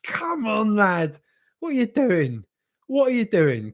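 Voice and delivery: high, strained cry of disbelief, not full volume; exasperated fan yelling. Acted. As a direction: plead with a player who has blown it. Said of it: "was shouting and screaming at him".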